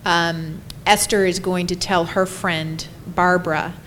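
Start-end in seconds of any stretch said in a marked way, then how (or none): none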